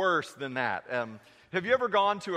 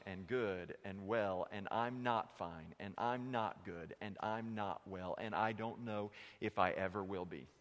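quiet confession; 6 semitones lower, 9 dB quieter than usual. Acted. Preacher voicing a hurting churchgoer, low and soft. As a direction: lower and softer, heavy with hurt.

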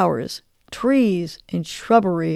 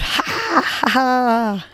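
laughing on the exhale; 3 semitones higher, much slower than usual. Shown as a demonstration. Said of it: "Go ahead and try it".

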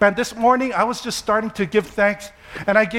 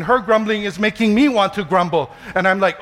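ordinary talk

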